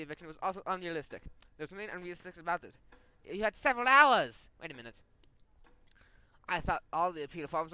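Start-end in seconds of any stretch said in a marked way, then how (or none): 3.2-4.5 louder, higher, slow exasperated retort; indignant outburst. Acted. Governor snapping at the tenant, loud and slow. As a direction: throw it back at her, offended.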